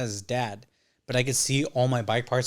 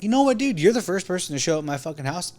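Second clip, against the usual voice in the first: much higher, louder indignant burst; heated retort; 6 semitones higher, 4 dB louder than usual. Retold or imitated. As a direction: fire back at a friend. Telling Mondo off.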